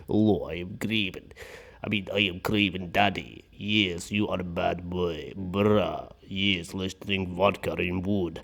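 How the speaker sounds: low, soft mock Russian accent; terrible Russian accent. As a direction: do a bad Russian-dad accent, slow and heavy. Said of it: "a terrible accent".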